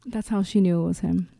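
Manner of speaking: ordinary talk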